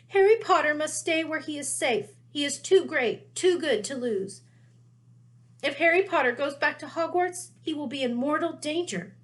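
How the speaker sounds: a little elf pleading in alarm; urgent house elf. Acted. Dobby voiced, urgent.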